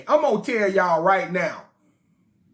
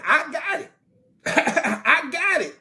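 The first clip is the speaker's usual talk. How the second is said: gloating laugh; 5 semitones higher, slower than usual. Acted. Olalu gloating.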